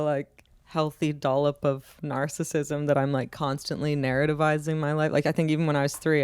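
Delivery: usual speaking voice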